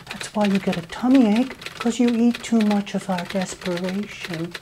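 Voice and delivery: high, soft cooing; mock baby talk. Taunting greedy banks in soft baby talk. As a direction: talk down like to a sulky toddler.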